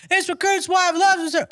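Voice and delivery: very high mock recruit yell; screaming recruit. Recruit defending his wife to the drill instructor, high mock scream. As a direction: imitate a recruit sounding off at the top of his range.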